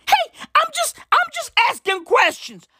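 very high, whiny squeal; mock defensive. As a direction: plead innocence in a squeaky voice. Mock innocent self-defence.

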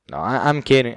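ordinary delivery

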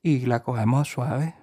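put-on slow, soft Puerto Rican accent, lightly done. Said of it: "el de [name] es como una voz más suave".

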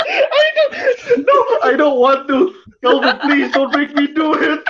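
high, laughing protest; laughing plea. Real, not acted. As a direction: beg through helpless laughter. Scared, pleading through laughter.

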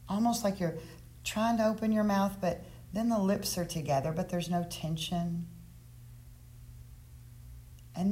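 guided meditation. Acted. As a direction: ease them into stillness.

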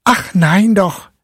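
put-on high, slowed boy's protest; young boy disagreeing. A narrator's reading. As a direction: object earnestly as Fritz. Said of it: "erwiderte Fritz".

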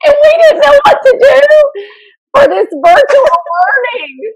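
high, loud squeal of laughter through the words; helpless laughter. Real, not acted. Podcast host losing it over her own point, laughing helplessly. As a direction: struggle to get the sentence out through real laughing. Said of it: "as soon as you pull yourself together".

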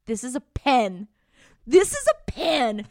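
teacherly mock Chiron; deadpan insistence. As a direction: insist slowly that it is just a pen. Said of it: "and he's like".

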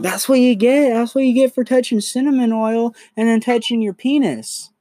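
high, loud mock-scolding mother; hammed-up high scolding mother voice; scolding mom. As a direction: tell him off like an unsympathetic mom. Mom voiced scolding her son.

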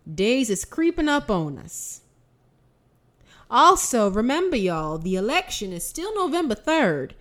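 put-on chirpy old church lady reading notices; fake old lady voice. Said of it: "my fake old lady voice".

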